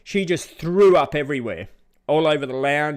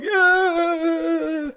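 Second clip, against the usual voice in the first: high, loud whooping yob cheer; drunken cheer; 5 dB louder than usual. Retold or imitated. Narrator imitating Australians cheering the woman in the lake, high whoop. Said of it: "all the Australians are like".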